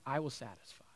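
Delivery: softly whispered promise. Real, not acted. Preacher whispering Jesus' promise to the crowd, soft whisper. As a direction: breathe it out, barely voiced.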